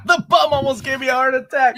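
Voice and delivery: high, loud, laughing after a fright. Real, not acted. Viewer tells co-host about the jump scare, laughing. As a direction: blurt it out through laughter.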